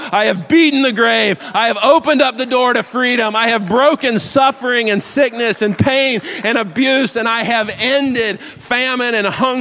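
preacher voicing Jesus declaring victory over death, loud and high; victorious proclamation. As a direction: proclaim each victory like a conqueror. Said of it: "he is actually saying in this moment".

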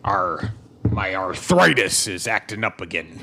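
put-on low, slow pained old-man voice; old dwarf groan. Cleric groaning about his arthritis to his god. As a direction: creak it out like an aching old dwarf.

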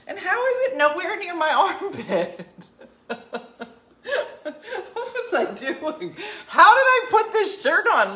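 high, loud, cracking up; laughing disbelief. Real, not acted. Baffled how deodorant landed nowhere near the armpit, real high laughing disbelief. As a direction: laugh through your baffled questions.

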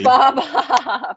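"Hope" drawn out as "hop", pitched high; mock mispronunciation. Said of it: "I accidentally deleted the E".

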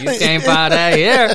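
put-on high, loud Atlanta accent through laughter; mock Atlanta street accent. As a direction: crack up while imitating Atlanta street talk.

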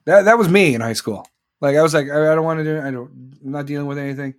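telling it big and loud; loud, heated retelling; loud storytelling. Real, not acted. Loud retelling of high school.

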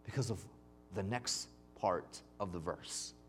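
normal talking voice

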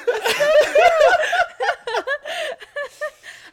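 long, loud, high-pitched laughing fit; uncontrolled delighted laughter; big laugh. Real, not acted. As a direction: burst into a long squealing laugh. Host moved by friends' tears.

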